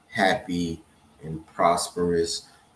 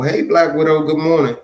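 loud, high, bright hello; excited greeting; 10 semitones higher, 11 dB louder, faster than usual. Real, not acted. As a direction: call out a cheerful hello. Greeting a viewer by calling out.

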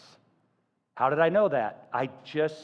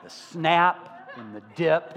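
ordinary talk, nothing marked

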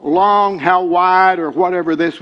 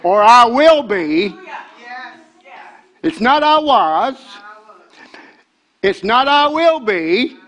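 higher, louder preaching cry; preacher still worked up; fervent preaching; 6 semitones higher, 4 dB louder than usual. Real, not acted. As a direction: keep the fire burning. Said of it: "calm me down".

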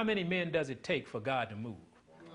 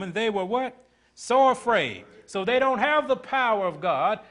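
higher and louder, rolling sermon cadence; preaching at full force; 7 semitones higher, 6 dB louder than usual. Acted. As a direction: preach it out to the congregation.

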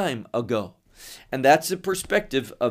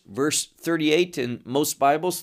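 ordinary delivery

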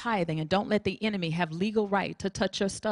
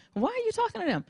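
incredulous high question; 14 semitones higher, faster than usual. Retold or imitated. Holy Spirit questioning, high pitch.

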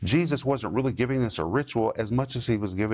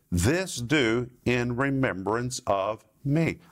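slow solemn quote; slower than usual. Acted. Preacher quoting Jesus' words solemnly.

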